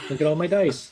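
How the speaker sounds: thrilled little goblin showing off a haul; excited goblin. Acted. Dice goblin gleefully showing off a handful of dice, excited. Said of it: "he's just, like, really, really excited".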